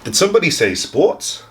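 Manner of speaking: spring into the ad; mock surprise. Sponsor segue, mock surprise.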